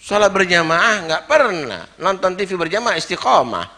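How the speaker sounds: loud high sarcastic jab; mocking. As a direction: sneer at the family's TV habit.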